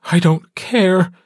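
wife growling at her mate, angry. A narrator's reading. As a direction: snap back in defiant anger. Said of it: "she growls at him".

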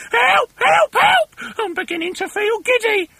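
piercingly high shrieks for help; high-pitched wailing wife; panicked screaming. A narrator's reading. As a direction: screech it hysterically. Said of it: "screamed Missus Twit".

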